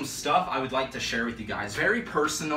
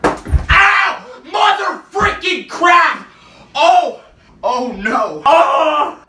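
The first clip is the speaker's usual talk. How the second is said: high, loud yelps of pain; real yelling in pain; pain shout; 11 semitones higher, 11 dB louder, much slower than usual. Real, not acted. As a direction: cry out from a stubbed toe. Stubs toe after jumping counter.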